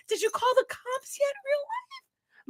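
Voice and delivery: squeaky mocking imitation of a crybaby; mocking whiny voice. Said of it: "you over here crying about".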